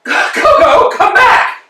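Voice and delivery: calling out. Acted. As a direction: shout it after him, high and loud. Calling after departing friend.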